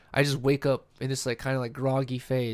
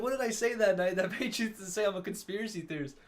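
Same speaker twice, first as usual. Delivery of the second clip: high, softer disbelief with a laugh; puzzled mock protest, pitched up; retold bafflement; 6 semitones higher, 6 dB quieter than usual. Retold bafflement at being called theorist.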